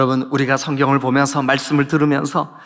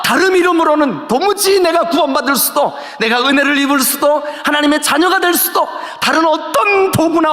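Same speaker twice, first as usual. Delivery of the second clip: high-pitched, insistent proclamation; impassioned pulpit declaration; full-force preaching; 12 semitones higher, 5 dB louder than usual. Real, not acted. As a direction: drive each clause up like a fervent preacher.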